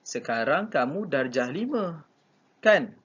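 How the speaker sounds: pause, then punch "kan?" as a check; tag question. Shown as a demonstration. Teacher asking a pupil about their school year, tag question. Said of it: "cuba kalau Mak Lim tanya".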